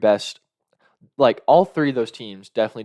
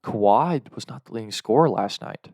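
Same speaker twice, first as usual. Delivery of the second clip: ordinary delivery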